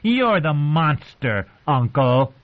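slow, high-pitched sneer. A narrator's reading. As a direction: throw it back with drawn-out contempt. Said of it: "Joffrey sneered".

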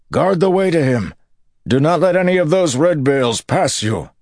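high, loud commanding roar. Acted. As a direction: bellow the command to hold the way. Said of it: "Perrin roared".

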